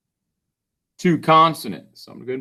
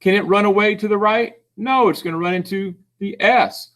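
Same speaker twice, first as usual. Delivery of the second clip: normal talking voice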